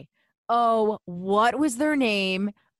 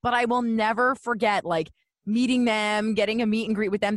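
ordinary talk

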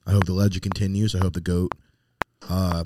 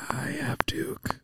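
hushed, breathy confession; whisper; 12 dB quieter, slower than usual. Real, not acted. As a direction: whisper it like a secret. A secret confession among friends.

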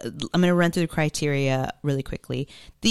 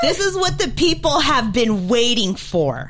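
excited; 5 semitones higher than usual. Real, not acted. Big reveal hype, laughing.

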